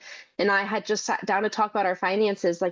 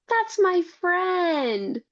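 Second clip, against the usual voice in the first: put-on little girl voice; 11 semitones higher, much slower than usual. Mother quoting her small daughter.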